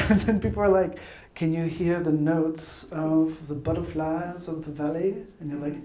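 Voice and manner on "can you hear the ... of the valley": slow, measured connoisseur voice; wine-tasting connoisseur. Retold or imitated. Wine taster asking a baffled guest, mock connoisseur. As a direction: mimic a wine snob savouring every word.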